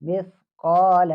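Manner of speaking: teacher modelling the correct form; correct misqaal. Shown as a demonstration. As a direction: stretch مثقال slowly, not مسکال. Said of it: "اس کو مسکال نہیں پڑھیں گے".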